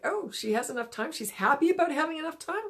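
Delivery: mock amazement, rising in pitch; puzzled fuggler. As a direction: wonder aloud like a baffled little gremlin. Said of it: "and it's like".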